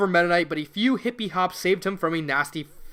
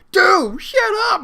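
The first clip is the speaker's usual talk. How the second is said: loud, high sharp bark; 14 semitones higher, 10 dB louder, slower than usual. Acted. Mac barks at Mega Man to hush. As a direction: bark him into silence. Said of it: "Mac barked".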